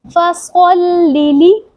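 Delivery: फसल्लि slow and high, lips rounded on the heavy स; rounded saad. Shown as a demonstration. Teacher demonstrates the rounded saad in फसल्लि. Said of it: "मुंह गोल करके".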